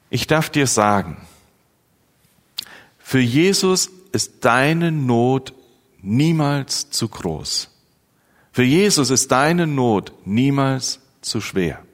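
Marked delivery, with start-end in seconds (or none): none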